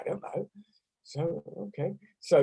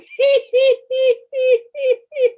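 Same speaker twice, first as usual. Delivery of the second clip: very high, loud he-he laughter; 7 dB louder than usual. Shown as a demonstration. Laughter teacher with the class, very high laughter. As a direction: laugh as high as you can. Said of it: "the higher the better".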